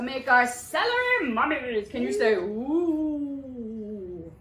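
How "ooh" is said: ordinary delivery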